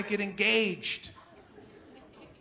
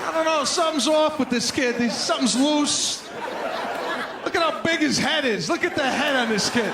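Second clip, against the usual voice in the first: blunt father; 4 semitones higher, 6 dB louder than usual. Retold or imitated. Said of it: "my father was like".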